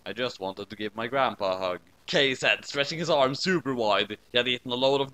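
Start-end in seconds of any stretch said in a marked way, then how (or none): 0.1-1.8 quiet sweet plea. A narrator's reading. Kay sweetly asks for a hug.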